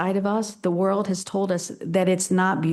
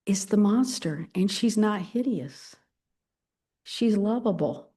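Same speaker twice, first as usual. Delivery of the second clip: ordinary talk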